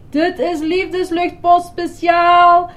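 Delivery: high, drawn-out loud yell. A narrator's reading. Grover flung over the wall, yelling. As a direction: holler it like a flying announcement. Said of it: "gilde Grover".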